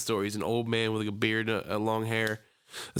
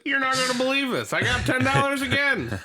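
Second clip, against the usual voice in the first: mock excited; 13 semitones higher, 4 dB louder than usual. Retelling what he told the clerk.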